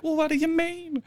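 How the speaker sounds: high prying question; imitating her. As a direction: push him, up high. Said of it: "she's like".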